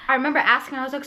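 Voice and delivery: ordinary delivery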